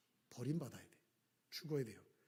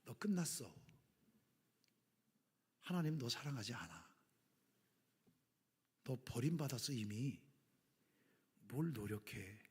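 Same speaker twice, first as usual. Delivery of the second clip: whisper; 4 semitones lower, 6 dB quieter than usual. Acted. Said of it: "사단이 우리에게 와서 속삭입니다".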